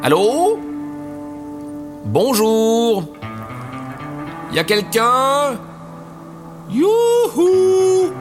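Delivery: high, calling out to the houses. Acted. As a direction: call out hello to nobody. Calling out at doors.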